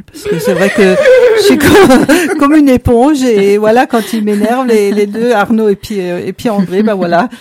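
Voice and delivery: loud, high, laughing through words; giggling out the confession; laughing while talking. Real, not acted. Laughing while admitting she absorbs stress.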